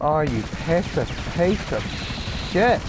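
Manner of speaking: builds to a loud, high shouted curse; real gamer rage, exploding on the last word; angry outburst. Real, not acted. Raging at a game opponent.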